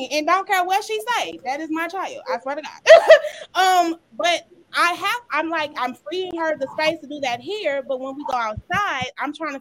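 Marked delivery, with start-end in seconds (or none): 2.8-3.5 loud high laugh. Real, not acted. Laughs at own child.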